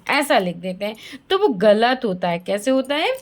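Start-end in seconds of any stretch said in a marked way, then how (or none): none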